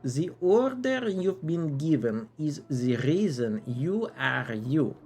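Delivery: theatrical knight with an exaggerated French accent; silly French accent. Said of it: "a very silly French accent".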